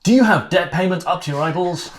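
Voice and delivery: loud, hammy TV pitchman, laughing; infomercial voice. Acted. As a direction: oversell it like a late-night ad. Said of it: "Infomercial [name]".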